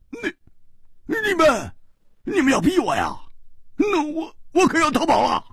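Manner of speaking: cornered and squeaking in alarm; panicked squeal. Acted. (被逼急了，尖声慌叫)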